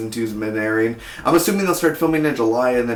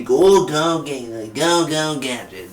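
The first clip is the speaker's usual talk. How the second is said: Inspector Gadget impression; 3 semitones higher, slower than usual. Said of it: "I used to do it really good".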